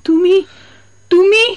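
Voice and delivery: can't believe who stands before her; stunned disbelief. Acted. Wife learns husband is alive. (হতবাক অবিশ্বাসে)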